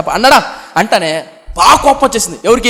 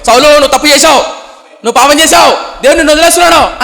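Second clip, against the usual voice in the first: higher, louder, fast accusation; prophet's rebuke; 5 semitones higher, 5 dB louder than usual. Retold or imitated. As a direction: hammer the verdict at Saul. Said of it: "సమయలు". (ప్రవక్త గట్టిగా గద్దింపు)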